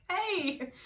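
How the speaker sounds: high-pitched, eager re-enacted hail; thrilled high greeting; excited greeting. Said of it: "I was really excited about that".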